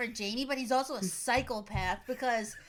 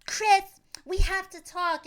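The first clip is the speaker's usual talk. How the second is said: put-on high, louder earnest girl; high girly voice; 7 semitones higher, 6 dB louder than usual. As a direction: call him in a high voice. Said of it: "she's like".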